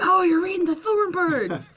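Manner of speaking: hammed-up delighted reaction to Thornbirds; impressed onlooker. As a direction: gush like a surprised fan of the book. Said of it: "were like".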